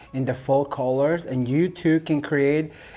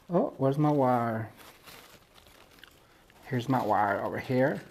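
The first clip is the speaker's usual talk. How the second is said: put-on Southern accent; 2 semitones lower, 5 dB quieter than usual. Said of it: "I'm practicing my Southern accent".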